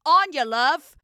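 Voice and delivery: loud, high Australian sleep-talk; Australian accent. Retold or imitated. Wife imitating sleep-talking husband, strong Australian accent. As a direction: call it out in broad Australian. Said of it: "in the most Australian accent".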